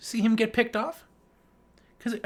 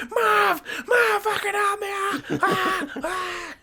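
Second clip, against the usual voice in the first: much higher, shrieking for help; mock terrified screams; 13 semitones higher, slower than usual. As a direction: cry out to Marv in panic. Victim screaming while being eaten.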